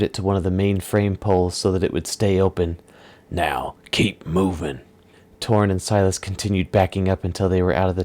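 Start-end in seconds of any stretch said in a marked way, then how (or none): 3.3-4.8 low, slow gruff threat; menacing villain. Acted. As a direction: lean on the threat like a thug. Villain orders them.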